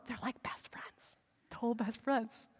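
whispered aside, slow. Retold or imitated. Townsperson whispering to a neighbour about Jesus. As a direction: lean in and whisper the boast.

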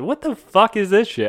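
high, incredulous mock outburst; retold disbelief. Recalling his reaction to the show, mock-appalled. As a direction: pitch it way up, as if appalled.

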